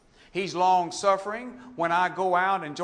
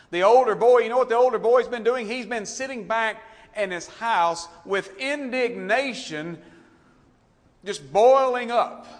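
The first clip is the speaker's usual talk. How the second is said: rising preaching; 5 semitones higher, 7 dB louder than usual. Real, not acted. Preacher building on the angry brother.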